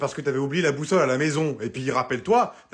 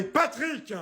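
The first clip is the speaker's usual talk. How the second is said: shrill high call of his name; yelling a name; 10 semitones higher than usual. Acted. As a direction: call him out angrily from afar. Wife yells at husband.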